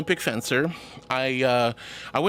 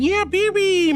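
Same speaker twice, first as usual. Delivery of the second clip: calling the cat; 5 dB louder, slower than usual. Real, not acted.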